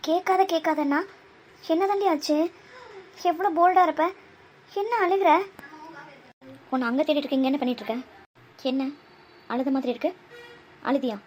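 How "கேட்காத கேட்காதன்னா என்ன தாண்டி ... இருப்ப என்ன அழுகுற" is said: higher, urgent questioning of an upset friend; raised, agitated voice; alarmed concern. Acted. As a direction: press them, worried and exasperated.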